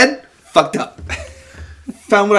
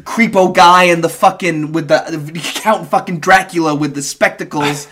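normal talking voice

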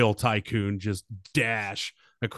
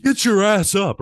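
loud, raised-pitch command; shouted order; 9 semitones higher, 9 dB louder than usual. Acted. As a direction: shout them up out of the seat. GM orders a seated player up.

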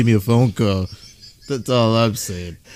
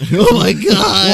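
mock child shock; 6 semitones higher, 4 dB louder, slower than usual.

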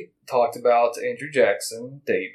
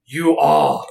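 exclaiming; 7 dB louder, much slower than usual. Acted. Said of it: "Lotosławski exclaimed".

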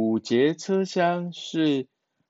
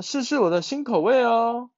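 penguin voice; 11 semitones higher, faster than usual. Acted. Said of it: "企鹅说".

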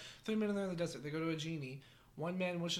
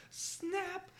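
high squeaky snap; 10 semitones higher, 4 dB quieter than usual. Acted. Genie snaps for third wish.

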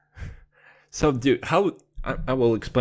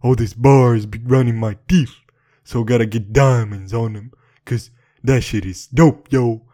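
louder swaggering rap voice; loud rapper hype; 7 dB louder than usual. Acted. Gangster persona bragging loudly about diamond grill. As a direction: boast it loud like a hype man.

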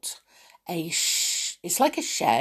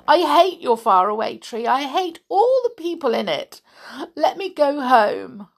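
weeping; 6 semitones higher, 5 dB louder than usual. Acted. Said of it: "she wept".

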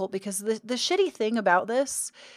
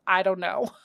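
laughing; 5 dB louder than usual. Real, not acted. Laughs at her own confession.

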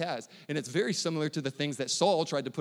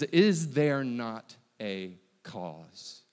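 drawn out very slowly, each word weighed; solemn, stretched-out delivery; slow emphatic quote; 3 semitones lower, much slower than usual. Shown as a demonstration. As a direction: let every word land, slowly. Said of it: "this is gonna be super important".

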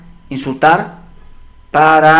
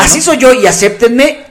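stubborn defiance; 5 semitones higher than usual. Retold or imitated. Stubborn character voice.

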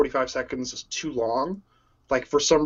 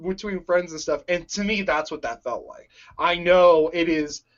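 ordinary delivery

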